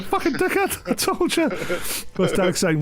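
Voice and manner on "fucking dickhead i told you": high, strained mock outburst at the sat nav; mock angry yell. Driver yelling at Google, high hammed-up mock anger. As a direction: hams up cursing the phone. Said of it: "And I was like".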